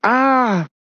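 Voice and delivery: contained high shriek; scream. Acted. Women employees see armed intruders, high mild scream. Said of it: "sontak menjerit".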